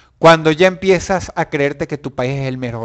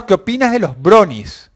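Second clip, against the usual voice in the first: ordinary talk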